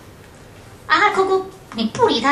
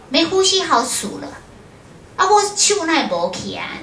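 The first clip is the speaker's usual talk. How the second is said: ordinary talk, nothing marked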